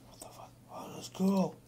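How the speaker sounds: hushed whisper, awed then pleased; whispered amazement. Retold or imitated. Retelling his reaction to the song, awed whisper. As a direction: breathe it out under your breath. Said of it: "and I was like".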